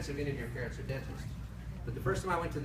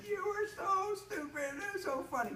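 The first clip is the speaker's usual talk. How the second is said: mocking kids. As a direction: sing it out like a mocking classmate. Kids mocking him at recess.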